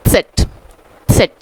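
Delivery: सेट begun with a ts as in उत्सव; German letter Z. Shown as a demonstration. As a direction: say Z as सेट with a ts start. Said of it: "जिस तरह हिंदी में उत्सव होता है". ("सेट" उत्सव वाले त्स से शुरू)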